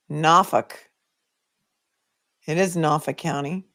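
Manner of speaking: Boston-accented local place name; light Boston accent with the R dropped. Shown as a demonstration. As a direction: say Norfolk the Boston way, "NAH-fuk". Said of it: "My Boston accent's coming along beautifully".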